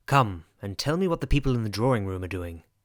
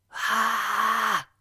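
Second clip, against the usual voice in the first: high scream; 9 semitones higher than usual. Acted. Scream in the night.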